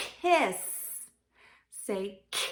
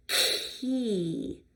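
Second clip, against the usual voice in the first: normal talking voice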